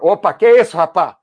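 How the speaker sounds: high, loud surprised exclamation. Real, not acted. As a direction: caught off guard, loud. Reacting to a surprising chat. (surpreso e alto)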